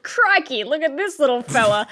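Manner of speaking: high, light mock Aussie accent; a kid's Steve Irwin Australian accent, hammed up; imitating kid's Australian accent. Mother retelling son's school Steve Irwin act, mock Australian accent. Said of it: "a little bit more South African than Australian".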